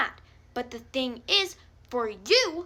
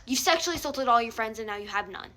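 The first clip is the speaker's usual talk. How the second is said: ordinary delivery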